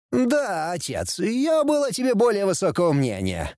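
put-on high-pitched, disdainful young heir; exasperated prince. A narrator's reading. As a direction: show your disappointment in a high voice. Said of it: "закатил глаза наследник". (разочарованно, высоким голосом)

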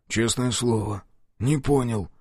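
plain reading voice

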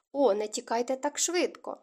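usual speaking voice

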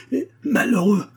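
very high, mocking echo; contemptuous sneer. A narrator's reading. Doctor mocking Abogin's claim of misery, sneering. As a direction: jeer the word back with scorn. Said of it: "ricana le docteur avec mépris".

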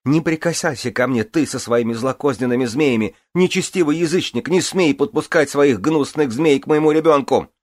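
high, loud furious tirade; shrieking rage. Acted. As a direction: shriek at him in fury. Queen screams at the druid.

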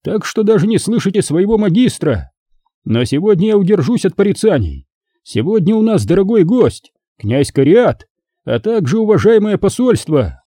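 high-pitched declamation to a hall; booming address. A narrator's reading. Magister calling drunken knights to attention, booming oratory. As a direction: proclaim it grandly, pitch well up. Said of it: "загрохотал Генрих".

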